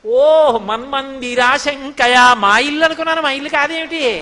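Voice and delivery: loud, drawn-out feigned astonishment; mock surprise. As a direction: play the child feigning innocent confusion. Child Krishna feigning innocent surprise.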